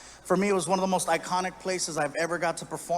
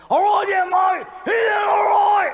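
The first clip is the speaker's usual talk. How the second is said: loud, high, garbled raspy holler; slurred pirate rasp, stretched out; raspy Australian drunk; 10 dB louder, slower than usual. Acted. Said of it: "He had more of a raspy voice".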